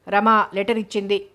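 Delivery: plain reading voice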